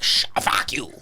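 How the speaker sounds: whispered and half laughing; whispered retort. Retold or imitated. Stan whispering back at his friend, laughing. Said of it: "And he was like".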